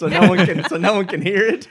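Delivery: loud, high-pitched mock yell; joking shout. Joking with co-hosts about a screaming child, shouted. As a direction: shout it way up high, playing it for laughs.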